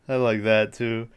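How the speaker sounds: ordinary talk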